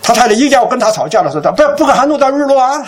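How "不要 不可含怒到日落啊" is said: high-pitched, hurried protest; mock urgent plea. Husband to wife about to argue, comic pleading. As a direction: imitate a husband heading off a quarrel.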